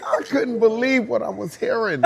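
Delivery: high, loud, laughing through the words; laughing in disbelief. Real, not acted. Telling how the Japanese dancer praised Henny-san, laughing hard. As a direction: laugh your way through the line.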